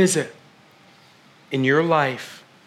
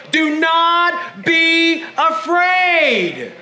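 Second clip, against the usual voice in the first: raised, drawn-out Do not be afraid; emphatic declaration; 8 semitones higher, much slower than usual. Shown as a demonstration. Preacher urging congregation to tell neighbours, emphatic. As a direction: proclaim it, stretching afraid. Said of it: "Turn to your neighbors again and say".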